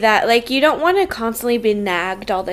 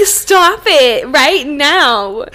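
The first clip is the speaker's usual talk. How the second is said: loud, drawn-out mock command; slow, emphatic, louder scolding; exasperated order; 2 semitones higher, 5 dB louder, much slower than usual. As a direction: stretch each word out in retold exasperation. Retold exasperated order about gulping.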